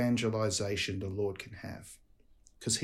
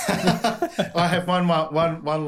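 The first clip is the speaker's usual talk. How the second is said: words spilling out through a laugh, higher; 5 semitones higher, 5 dB louder, slower than usual. Real, not acted. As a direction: chuckle through it, slow. Laughing while answering the question.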